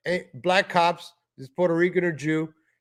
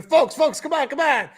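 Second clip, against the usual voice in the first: high, loud imitation of a hype man; hammed-up hype man hushing everyone; mocking hype man; 8 semitones higher, 4 dB louder than usual. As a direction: plead with the crowd in mockery. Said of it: "You saw his hype man".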